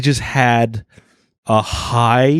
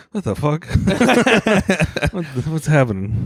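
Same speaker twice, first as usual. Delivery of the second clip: confused waking; 5 semitones higher than usual. Retold or imitated. Comedian retelling how they wake up every morning, confused. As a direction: act out groggy bewilderment, breaking into laughter. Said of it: "I wake up every morning confused".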